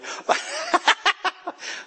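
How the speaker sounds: high burst of laughter; hearty laugh. Real, not acted. Pastor laughs after teasing a congregant, high burst. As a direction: laugh hard at your own joke.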